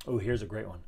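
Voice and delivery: usual speaking voice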